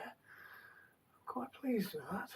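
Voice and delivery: soft pleased whisper. Real, not acted. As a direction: whisper it to yourself, satisfied. Quiet self-satisfied aside.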